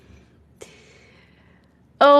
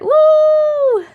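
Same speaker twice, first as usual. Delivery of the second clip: high, loud "Woo!"; cheer; 7 dB louder than usual. Real, not acted. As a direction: cheer like a fan. Cheering for a viewer.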